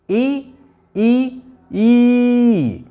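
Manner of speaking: ई held long and steady; drawn-out ई. Shown as a demonstration. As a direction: pull ई out long without changing it. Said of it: "खूब देर तक इसकी आवाज़ को खींचिए". (ई को देर तक खींचकर बोलें)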